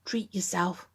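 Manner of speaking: whispered temptation, no voice at all; whispered nudge at the checkout; addict's whisper. Retold or imitated. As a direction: breathe it like a sly little tempter. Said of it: "And the addict just says".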